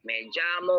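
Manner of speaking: "llamo" begun with an English J sound. Shown as a demonstration. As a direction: say the LL in "llamo" like J in jam. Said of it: "can sound like J". ("llamo", binibigkas ang LL na parang J)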